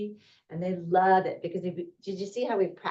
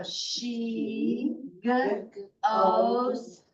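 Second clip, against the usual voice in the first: "she goes" read very slowly, word by word; slow classroom read-along; slow choral reading; much slower than usual. Shown as a demonstration. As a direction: read it out slowly for kids to follow. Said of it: "once we've sounded out, we read it".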